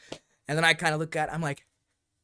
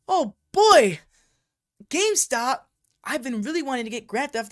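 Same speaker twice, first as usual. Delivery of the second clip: high, loud burst of delight; over-the-top eager outburst; excited kid; 8 semitones higher, 7 dB louder than usual. Acted. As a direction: exclaim it like a thrilled sixth grader. Acting his excited younger self.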